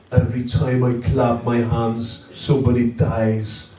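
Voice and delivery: put-on slow, solemn Irish accent; measured, grave delivery with an Irish accent. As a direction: intone it slowly in Irish. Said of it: "[name] from U two".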